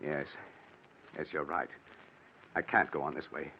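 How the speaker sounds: a man at the end of his rope, barely voiced; broken, hushed admission; defeated quiet. Fiancé gives in, worn down.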